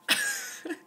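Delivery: quick high-pitched giggle. Real, not acted. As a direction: giggle breaks out. Giggle after saying she's jazzed.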